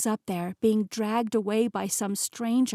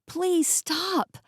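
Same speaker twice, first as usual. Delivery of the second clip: pleading shout; 9 semitones higher than usual. Acted. Begging mother to stop.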